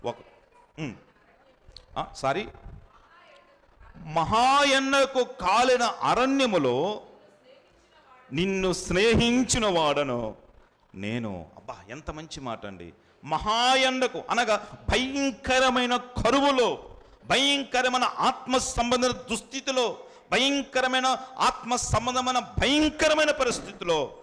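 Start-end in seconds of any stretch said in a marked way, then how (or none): 4.0-11.5 slow, higher reading of the verse; scripture reading. Acted. Preacher reads Hosea slowly to the congregation. 13.2-24.1 much higher, pressing each phrase; fervent preaching. Real, not acted. Preacher stresses the terrible drought to listeners, fervently. Preacher expounds verse heatedly.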